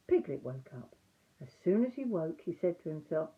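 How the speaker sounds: plain reading voice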